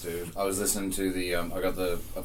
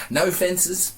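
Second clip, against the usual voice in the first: high, pleading mock English accent; impersonating English co-host; 11 semitones higher, 4 dB louder than usual. Guest plays co-host wanting land for cars, mock English accent. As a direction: pitch it up into a hammed-up English impression. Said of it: "that's my impersonation of you".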